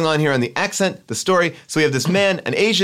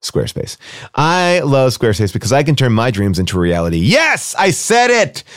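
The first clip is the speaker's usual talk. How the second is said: louder, pushy pitchman sponsor read; 2 semitones lower, 4 dB louder than usual. Acted. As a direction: sell it like a hammy host ad.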